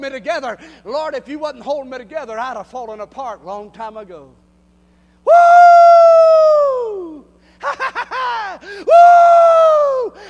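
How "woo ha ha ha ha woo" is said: high, loud whoop into a burst of laughter; joyful whoop and laugh. Real, not acted. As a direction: let joy explode out of you. Preacher erupts in joy.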